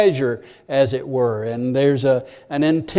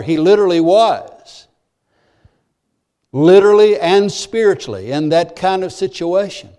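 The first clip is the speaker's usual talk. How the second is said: ordinary talk, nothing marked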